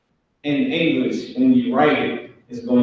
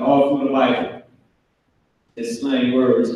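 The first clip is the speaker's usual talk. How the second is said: ordinary talk, nothing marked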